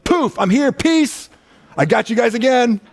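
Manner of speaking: high, bright teasing surprise entrance; playful Jesus. Retold or imitated. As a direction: pop in like a prankster. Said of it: "He's like".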